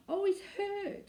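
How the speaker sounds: high, slow frightened shriek. A narrator's reading. Anthea shrieking as the women tug the baby. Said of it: "shrieked Anthea".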